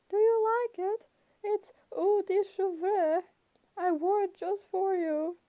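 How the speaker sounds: flirty fake bride voice; falsetto. A narrator's reading. Grover's falsetto.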